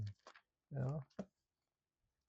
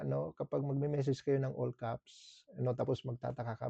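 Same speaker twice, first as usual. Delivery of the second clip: ordinary delivery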